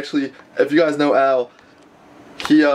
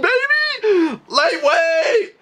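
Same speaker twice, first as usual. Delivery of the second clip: very high celebratory cry; ecstatic squeal; much slower than usual. Real, not acted. Just got size ten Yeezys, ecstatic squeal. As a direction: let out a long, squealed victory cry.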